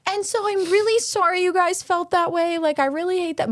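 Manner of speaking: imitating a hammed-up sorry; mock genuine apology. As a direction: drag out the apology in a high voice. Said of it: "the way she was saying it was like".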